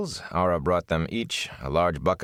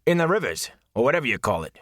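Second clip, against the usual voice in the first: higher, abrupt attendant's voice; abrupt question; 6 semitones higher, faster than usual. Acted. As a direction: blurt it out suddenly. Ara continues.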